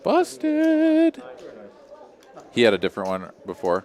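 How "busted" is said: high squeaky mocking echo; squeaky busted. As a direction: squeak it out in mock glee.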